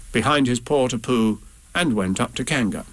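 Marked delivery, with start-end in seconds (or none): none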